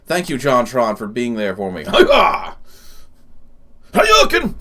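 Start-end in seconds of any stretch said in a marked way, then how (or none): 1.9-4.5 silly yell. Real, not acted. Silly exclamation while laughing.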